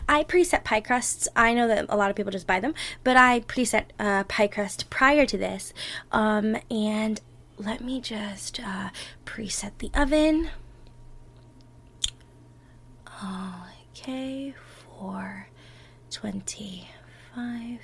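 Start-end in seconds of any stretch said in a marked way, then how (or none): 6.1-17.8 slow, soft whisper. Acted. Partner starting the baking together, slow soft whisper. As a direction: whisper gently as if not to wake anyone.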